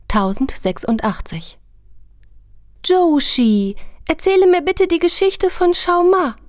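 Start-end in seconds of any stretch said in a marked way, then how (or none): 2.8-6.3 put-on high, eager child voice; a small boy begging for a story; kleiner Junge. Kleiner Junge bittet um Geschichte. (hohe Kinderstimme, bittend)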